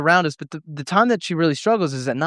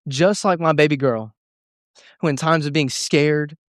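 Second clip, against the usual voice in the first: ordinary talk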